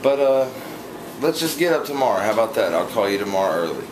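ordinary talk, nothing marked

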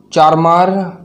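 usual speaking voice

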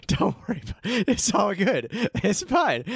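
high, jittery reassurance; nervous backpedal. Retold or imitated. Scared golfer refusing Saeed's euros, high nervous babble. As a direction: wave off the money in a panic.

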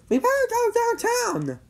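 high-pitched urgent exclamation; alarmed urging. A narrator's reading. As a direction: urge him to leave at once in a high voice. Shocked parent urging action.

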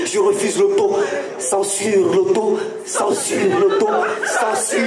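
l'auto-censure drawn out slowly, repeated three times; grand tragic stage delivery. Acted. Slam poet repeats word slowly.

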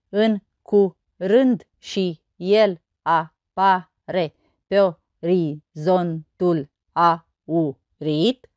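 very slow, each syllable separated. Shown as a demonstration. Teacher counting verse syllables on fingers, syllable by syllable. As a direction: count out the syllables one at a time. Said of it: "Și să nu uităm de degete".

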